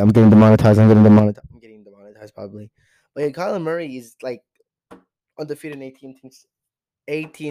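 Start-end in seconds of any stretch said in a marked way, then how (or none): 0.0-1.4 very loud, repeated blurt; loud, low-pitched chant; demonetized alarm. Real, not acted. Panicked joke about losing money. 1.5-2.7 quick, soft aside; hushed, hurried admission; offhand afterthought. Real, not acted. Drops back after loud repeats.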